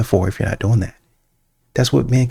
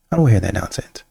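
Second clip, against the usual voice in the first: ordinary talk, nothing marked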